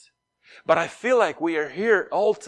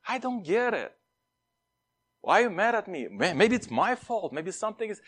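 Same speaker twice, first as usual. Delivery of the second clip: higher, lightly voiced puzzled student; voicing the honest student; 5 semitones higher than usual. Confused student asking the upset teacher why he is mad, higher. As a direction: plead innocently with the teacher.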